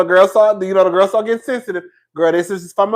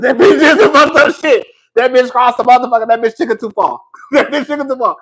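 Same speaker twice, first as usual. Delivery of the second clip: loud, high, laughing gossip; crowing with delight over the scandal; gleeful gossip; 5 semitones higher, 6 dB louder than usual. Real, not acted. Friend gossiping to the girls, loud and delighted.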